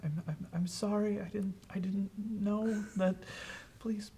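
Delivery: high, shaky, stammered plea; scared stammering. Acted. Thomas at the shed begging, high and stammering. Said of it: "turns to you and says".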